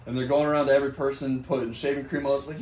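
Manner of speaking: ordinary talk, nothing marked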